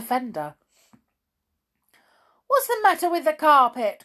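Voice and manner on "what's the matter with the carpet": high, loud, fast angry cry; raging cry. Acted. Amelia Jane raging after falling on the carpet. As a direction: snap it out in a rage. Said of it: "cried Amelia Jane in a rage".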